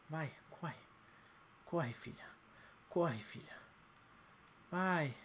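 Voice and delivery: whispered urging. Real, not acted. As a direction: urge it in a whisper. Urging under breath.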